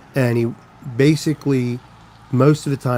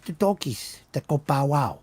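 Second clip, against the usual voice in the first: imitating Pennsylvania Dutch accent; 3 semitones higher than usual. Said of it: "thick, heavy accent".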